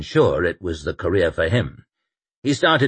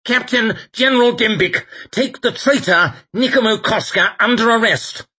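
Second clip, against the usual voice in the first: high, loud commanding bark; barked order; 5 dB louder than usual. A narrator's reading. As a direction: snap the command out. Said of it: "Lawson's voice barked out".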